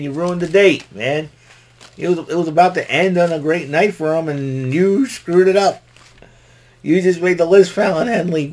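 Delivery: loud, high scolding rant; hammed-up outraged rebuke; mock scolding. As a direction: scold her like she wrecked the date. Voicing a scolding wrestler.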